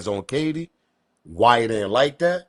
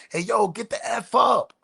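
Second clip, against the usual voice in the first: panicked scream; 10 semitones higher than usual. Retold or imitated. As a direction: imitate a high, panicked yell. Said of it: "You can hear the panic in the voice".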